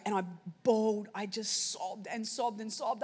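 ordinary delivery

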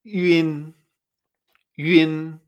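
"Yun" as ü-in, held level; ü plus in. Shown as a demonstration. Teacher blending the sounds for learners, "Yun" as ü-in. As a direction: say "Yun" with ü sliding into in. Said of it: "y luego una I N".

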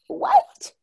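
high, loud rising what; indignant disbelief. Retold or imitated. Aunt confronting teen nephew, high indignant disbelief. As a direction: demand he repeat it, outraged. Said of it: "I was like".

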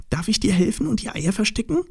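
high, fast, eager little piglet; very excited. Acted. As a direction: rush the question out up high, rising at the end. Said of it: "ganz aufgeregt".

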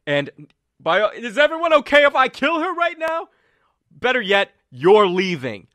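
high, loud commanding shout. Acted. Colm yelling at Farron to calm down, loud and high. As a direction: yell her down, furious and alarmed.

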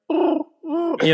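high cooing pigeon voice. Retold or imitated. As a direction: coo it like a pigeon. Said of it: "just me going like".

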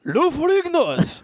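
high, drawn-out mock samurai Japanese accent. Co-host doing a sixteenth century samurai, fake Japanese accent. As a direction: proclaim it as a hammy Japanese samurai. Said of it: "you do one in a non-racial Japanese accent".

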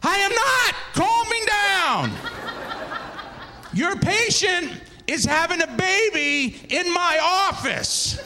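high, worked-up comic protest; frantic refusal. Retold or imitated. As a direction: stretch out each word in exasperation. Refuses doctor's order to calm down.

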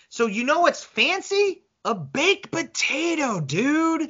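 high, slow, triumphant reveal. Real, not acted. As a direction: savour the punchline slowly. Triumphant point in the food argument.

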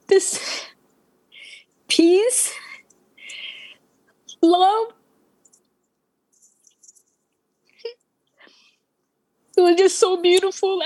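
high, halting, deeply moved; overcome with emotion. Real, not acted. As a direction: voice lifted, long pauses between words. Overcome recalling the feeling of peace.

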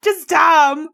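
high, drawn-out mock wail; hammed-up tearful protest; crying. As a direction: wail it like a hurt little kid. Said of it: "I'm crying".

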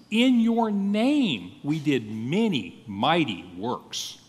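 slowly weight "In your name", stepping down through "mighty works"; stress on IN YOUR NAME. Shown as a demonstration. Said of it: "give you the sense of the emphasis".

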